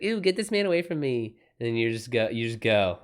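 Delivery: normal talking voice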